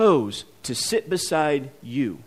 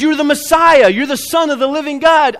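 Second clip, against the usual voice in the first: loud, high, confident proclamation; bold declaration; 8 semitones higher, 8 dB louder than usual. Acted. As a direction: shout it out with total certainty like bold Peter. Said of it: "Peter's bold".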